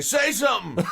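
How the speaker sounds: high, loud mock child demand; kids' taunt. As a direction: play the kids egging him on. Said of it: "the kids would say".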